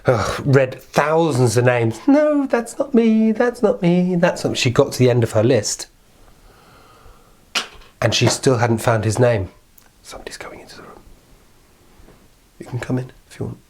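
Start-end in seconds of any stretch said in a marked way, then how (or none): 2.1-4.6 high, slow teasing little man; sing-song denial, high and slow; little man's no. Acted. Little man teasing the queen, high and slow. 10.0-13.6 real whisper aside. Real, not acted. Narrator warning of someone entering, whispered. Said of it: "Shh, quietly".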